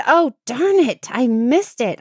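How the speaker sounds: loud, high, annoyed groan; retold annoyance over missed footage; frustrated.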